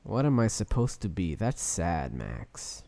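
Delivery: low, soft mopey mock; mocking whine. Host mocks his co-host's gloomy complaint, low and soft. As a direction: mope it back at him.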